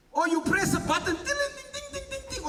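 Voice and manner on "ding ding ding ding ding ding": high, soft dings; mocking a microwave chime; imitated microwave ding.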